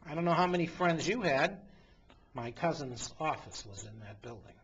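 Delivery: ordinary talk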